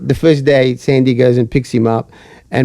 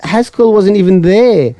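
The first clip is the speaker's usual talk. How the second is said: insistent; 6 semitones higher than usual. Retold or imitated. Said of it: "I said".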